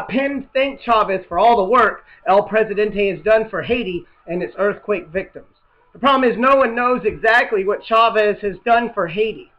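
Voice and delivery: ordinary talk, nothing marked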